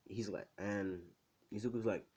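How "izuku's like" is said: plain reading voice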